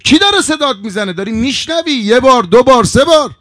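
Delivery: high, insistent arguing with oneself; heated acted inner quarrel; agitated self-questioning. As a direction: challenge yourself, hammering out the count. Hor argues agitatedly with himself.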